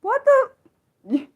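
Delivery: high, squeaky what the; stunned disbelief. Real, not acted. Streamer stunned by a place name, squeaky disbelief. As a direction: gasp it out in disbelief. Chat shows a long name.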